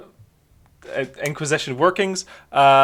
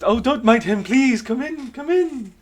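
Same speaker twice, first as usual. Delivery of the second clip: welcoming voice; 8 semitones higher than usual. Acted.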